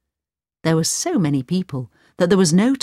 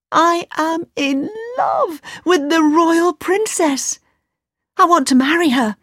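impassioned declaration; 11 semitones higher than usual. Acted. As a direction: cry out the love to mother. Aladdin declares his love.

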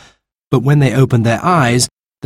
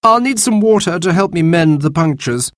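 put-on young man's voice; 9 semitones higher than usual. Baby asks for water.

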